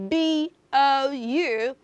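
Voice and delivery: letters read slowly one by one; slow letter-by-letter spelling. Shown as a demonstration. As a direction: spell B O U Q U E T deliberately for kids. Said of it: "today is the word".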